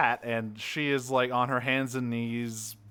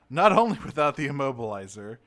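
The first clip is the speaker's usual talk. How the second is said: ordinary talk, nothing marked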